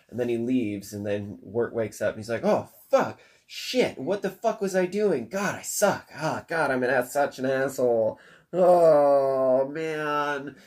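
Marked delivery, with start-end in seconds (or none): 2.4-10.5 a touch of panic as he realizes; panicked self-blame. Retold or imitated. Wirt wakes, brother gone.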